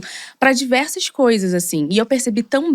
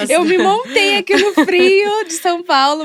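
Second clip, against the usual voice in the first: laughing; 10 semitones higher, 3 dB louder than usual. Real, not acted. Guest joking about dressing up.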